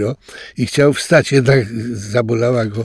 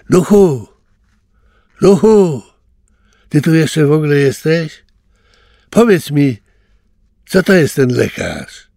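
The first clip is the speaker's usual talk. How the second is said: louder, higher calls to the hidden spirit; calling out; 6 semitones higher, 5 dB louder than usual. Acted. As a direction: call out to find the ghost. Calling out to the ghost. (wołanie ducha, głośniej i wyżej)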